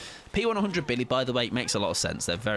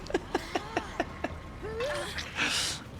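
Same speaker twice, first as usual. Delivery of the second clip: high giggle; 6 dB quieter than usual. Real, not acted. Laughing after apologising to Pickles.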